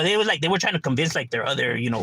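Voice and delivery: normal talking voice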